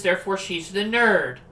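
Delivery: ordinary talk, nothing marked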